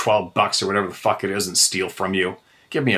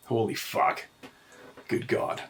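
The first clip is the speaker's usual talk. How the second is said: muttered under breath; 10 dB quieter than usual. Real, not acted. Cooling off after rant.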